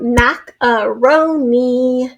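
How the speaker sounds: macaroni very slowly, in four separate beats; drawn-out teaching demo; syllable by syllable. Shown as a demonstration. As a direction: sound out each part of macaroni. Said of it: "a four syllable word".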